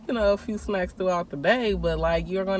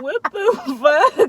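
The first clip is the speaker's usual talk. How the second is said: a slow, much higher and louder yell through a laugh; laughing mock shout; 10 semitones higher, 8 dB louder, much slower than usual. Girl shouting at her friend over the unfair punishment, laughing and drawn out. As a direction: stretch it out like disbelief.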